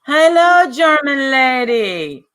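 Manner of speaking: high, drawn-out mock German accent. Greeting German lady on stream, mock German accent. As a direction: call out loudly in a put-on German accent. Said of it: "was that a German accent?".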